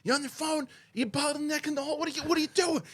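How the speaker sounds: high-pitched mock scream of outrage; retold screaming. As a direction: imitate an irate dad yelling in a high voice. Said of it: "He's screaming at it".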